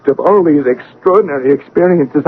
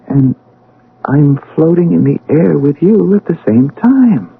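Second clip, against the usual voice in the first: ordinary delivery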